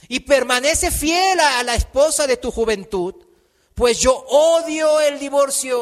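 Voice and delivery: loud, high pulpit proclamation; full-force preaching. Real, not acted. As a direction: declare God's will to the congregation. Preaches and declaims scripture.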